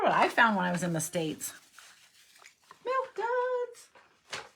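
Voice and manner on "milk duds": high-pitched, gleeful little squeal; delighted singsong. Real, not acted. Crafter shows viewers her candy find, delighted singsong. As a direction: sing out the treat's name up high.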